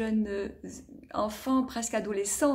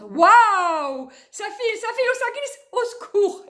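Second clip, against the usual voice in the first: much higher, loud squeals; thrilled slide cry; 11 semitones higher, 9 dB louder than usual. Acted. Sliding down the slide.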